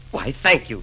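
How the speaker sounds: ordinary talk, nothing marked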